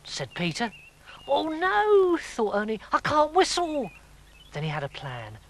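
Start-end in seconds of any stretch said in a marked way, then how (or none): 1.3-3.9 panicked high voice. Acted. Ernie panics, can't whistle.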